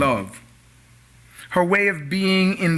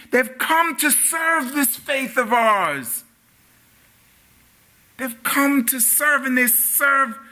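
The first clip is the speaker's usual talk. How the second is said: higher-pitched pulpit proclamation; preaching; 7 semitones higher than usual. Real, not acted. Preacher lifts into oratory.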